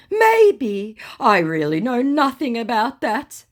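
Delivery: high, put-on innocent scammer voice; mock scammer voice. Scammer feigning innocence to the narrator, high mock voice. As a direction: play the wide-eyed innocent. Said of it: "said my scammer".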